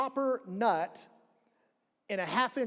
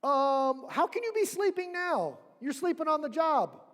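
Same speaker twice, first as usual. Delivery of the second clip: much higher, incredulous scolding; a panicked disciple nagging a sleeping teacher; exasperated disciple; 7 semitones higher than usual. Retold or imitated. Disciple scolding Jesus for sleeping through the storm, incredulous. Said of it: "He's like".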